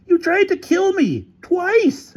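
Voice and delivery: very high, slow, emphatic charge; high-pitched outrage; angry accusation. Acted. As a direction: accuse him, stretching each word. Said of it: "accused Featherstone".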